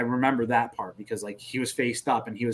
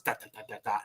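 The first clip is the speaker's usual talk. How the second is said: soft rapid rat-a-tat; imitated machine-gun fire; 9 dB quieter than usual. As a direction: mimic distant guns quietly. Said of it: "just talking guns".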